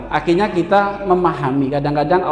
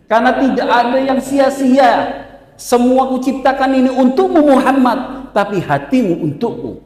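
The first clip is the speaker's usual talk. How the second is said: fervent quote; 7 semitones higher, 4 dB louder than usual. Retold or imitated. Quoting God, rising fervor.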